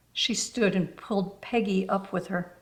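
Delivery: plain reading voice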